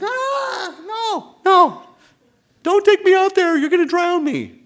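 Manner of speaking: very high, loud mock screaming; hammed-up squealing panic; panicked child. Acting child panicking in deep water.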